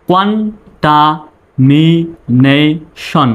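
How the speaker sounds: drawn out for repeating; slow call-and-repeat demo; slow model. Shown as a demonstration. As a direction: model it slowly to echo. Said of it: "আমার পরে আপনি বলবেন".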